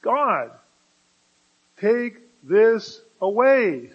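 loud, slow desperate plea to God; pleading prayer. Acted. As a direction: beg God with everything, drawn out. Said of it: "Three times I pleaded, I begged".

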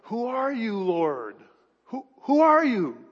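high, overwhelmed cry to the Lord; awestruck plea. Acted. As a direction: cry it out from your knees, stammering the repeat. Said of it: "He's on his knees. His face is in the dirt".

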